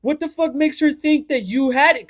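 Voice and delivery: high-pitched indignant questioning; offended disbelief. Retold or imitated. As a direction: act out a friend outraged on your behalf. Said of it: "He was genuinely, like, offended".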